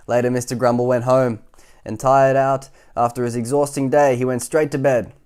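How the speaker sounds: energetic bedtime-story narration; reading to children. A narrator's reading. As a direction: tell it big for little listeners.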